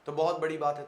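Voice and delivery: normal talking voice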